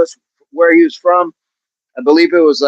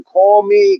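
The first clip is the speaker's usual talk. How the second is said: put-on Boston accent; 7 semitones higher than usual. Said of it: "was [name] from Boston?".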